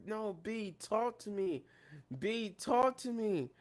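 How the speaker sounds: imitating tearful pleading. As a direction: beg quietly, as if crying. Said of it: "And she's crying".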